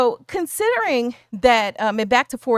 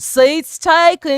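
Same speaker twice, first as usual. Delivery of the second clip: slow, louder, higher Southern drawl on "Seats taken."; country boy accent; 6 semitones higher, 7 dB louder, much slower than usual. Retold or imitated. Showing the chat the boy's accent in a louder, higher drawl. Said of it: "mimicking the way the boy says".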